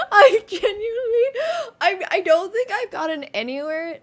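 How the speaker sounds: high, loud, tearful with laughter; breaking up in amused disbelief; helpless laughing. Real, not acted. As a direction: struggle to speak through the giggles. Still laughing at the result.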